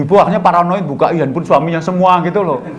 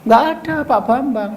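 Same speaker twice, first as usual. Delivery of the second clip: woman on the phone; 8 semitones higher than usual. Retold or imitated. Woman on phone answers wife.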